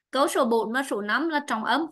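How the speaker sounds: normal talking voice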